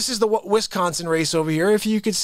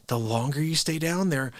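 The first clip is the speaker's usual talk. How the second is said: ordinary talk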